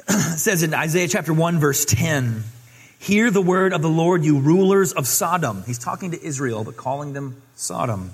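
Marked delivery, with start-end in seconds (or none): 3.0-5.6 loud, proclaiming Bible reading; booming scripture proclamation. Acted. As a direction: read it out like a prophet's call. Reading Isaiah aloud in sermon.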